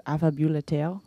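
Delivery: "affabulateur" said the French way, rising at the end; French vowels on "affabulateur"; careful French pronunciation. Shown as a demonstration. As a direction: say "affabulateur" as af-fa-byu-la-tehr. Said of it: "if I'm gonna say it correctly".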